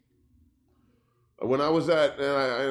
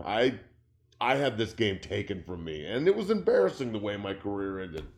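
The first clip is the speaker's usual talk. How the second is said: ordinary delivery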